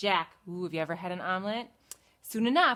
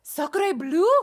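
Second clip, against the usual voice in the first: higher, louder duck with a French exclamation; 6 semitones higher, 6 dB louder than usual. Acted. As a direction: huff like an offended French duck. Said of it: "cried the duck".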